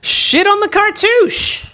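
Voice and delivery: high, drawn-out comic call; mock announcer voice. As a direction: draw it out high like a silly announcement. Patron names performed in put-on voices.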